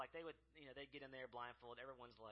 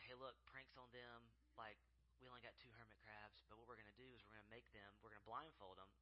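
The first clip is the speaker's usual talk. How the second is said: conspiratorial hush; 7 dB quieter than usual. Retold or imitated. Telling everybody the secret plan.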